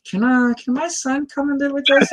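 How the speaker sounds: high-pitched mock mother voice; imagined mother. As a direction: play a mom pleading in a put-on high voice. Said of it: "she's gonna be like".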